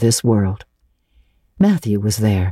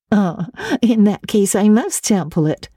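laughing; 7 semitones higher than usual. A narrator's reading. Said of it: "laughed Missus Allen".

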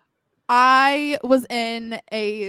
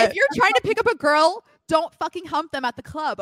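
emphatic rant; 6 semitones higher, 5 dB louder than usual. Real, not acted. Ranting advice to men.

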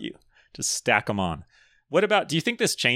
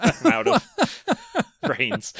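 laughing; 12 semitones higher, 4 dB louder, much slower than usual. Real, not acted. Laughing through the joke.

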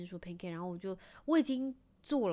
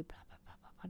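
voiceless whisper-patter noises; dad whispering in his son's ear, voiceless patter; imitated whispering; 17 dB quieter than usual. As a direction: mimic secret murmuring in an ear. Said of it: "偷偷在他耳边耳语".

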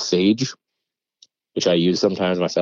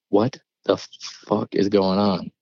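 slow, spaced-out words of disbelief; retold not calm, stunned; much slower than usual. Storyteller reacting to cousin's calm question, slow and stunned. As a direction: imitate being dumbfounded, each word dragged out. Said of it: "and I'm not calm".

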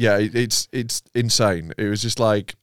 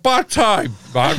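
weary retort; 9 semitones higher, slower than usual. Retold or imitated. Rob's retold reply to Raymond.

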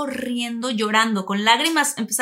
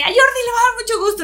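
much higher, louder and fast; excited promise; 13 semitones higher, 7 dB louder, faster than usual. Retold or imitated. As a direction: gush it out happily. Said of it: "le dije". (entusiasmo rápido y agudo)

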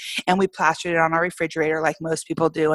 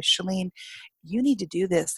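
weak, softer voice of a very sick husband; 6 dB quieter than usual. Retold or imitated. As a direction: say it quietly, like you are ill in bed. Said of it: "my husband, as sick as he was".

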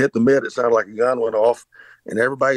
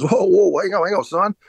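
amazed scout; 8 semitones higher than usual. Retold or imitated. Scout stops the tryout, amazed.

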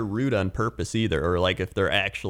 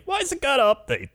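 confused dad voice. Retold or imitated. Imitating dad.

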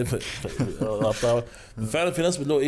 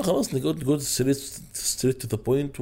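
ببطء وتأكيد; 3 semitones higher, much slower than usual. Acted. يؤكد البساطة والمباشرة.

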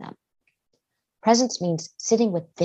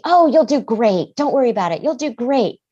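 raised, breezy mock reassurance; dismissive reassurance; 6 semitones higher, 6 dB louder than usual. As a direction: imitate a parent brushing worries away. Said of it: "we just kind of dismiss it".

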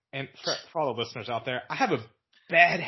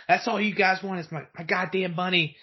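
higher, resentful jab; bitter accusation; 5 semitones higher than usual. Retold or imitated. Imitating grandma accusing them over her money, higher and resentful. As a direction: spit out the grievance. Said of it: "And I was like".

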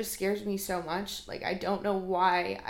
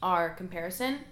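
ordinary delivery

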